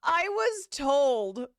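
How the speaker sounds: hammed-up smug drawl; smug mock. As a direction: stretch it out with smug certainty. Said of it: "who told you that?".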